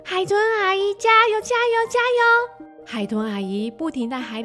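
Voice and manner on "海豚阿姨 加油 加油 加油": put-on high, loud child's cheer; excited cheering. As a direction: cheer her on like an eager girl.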